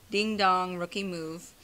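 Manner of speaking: ordinary talk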